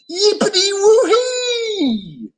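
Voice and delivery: very high, slow whoop of joy. A narrator's reading. The zoo animals celebrating, very high cheer. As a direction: celebrate wildly.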